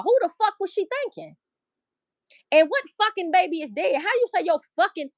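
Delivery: high-pitched, indignant questioning; incredulous outrage. Real, not acted. Appalled at how a mother spoke of her dead child, incredulous outrage. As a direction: demand answers in high-pitched disbelief.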